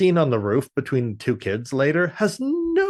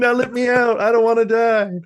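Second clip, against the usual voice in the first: higher, louder desperate plea; panicked kid; 11 semitones higher, 6 dB louder than usual. Retold or imitated. Co-host joins the mimicry, high pleading kid. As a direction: beg to get out, terrified.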